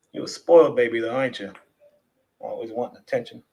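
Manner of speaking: low, softening murmur to a pet; doting low grumble; fond teasing. Real, not acted. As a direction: tease your cat affectionately, voice dropping.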